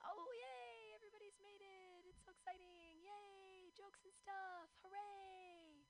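high, squeaky mock cheer; hammed-up high-pitched yay; squealy fake excitement. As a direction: squeal it like a giddy fan. Mock excited squeal greeting crowd.

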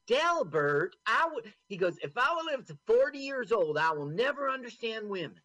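exasperated man. Retold or imitated.

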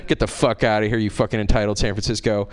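usual speaking voice

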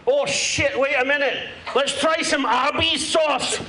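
mock Glaswegian comic voice, played broad; a lightly held Scottish accent; put-on Scottish accent. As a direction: talk like a brash Scotsman fresh off the plane. Holds a put-on Scottish accent until admitting he's London-born.

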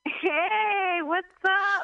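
high hammed-up hype-man hello; playful high announcer call; mock MC greeting. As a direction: greet the crowd like a stage host.

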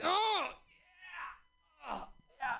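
sleepy soft drawn-out oh yeah; groggy waking. Retold or imitated. Waking up drunk in the Uber, soft groggy drawl. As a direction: come to groggily from a blackout. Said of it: "I just like".

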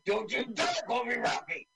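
high-pitched, hammed-up outrage; mock indignation. Ben Grimm objecting to his new nickname, mock indignation.

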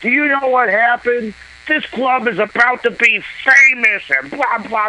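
mock the angry comic ranting at the crowd; hammed-up furious tirade, pitched high; pissed-off rant. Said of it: "fucking pissed off".

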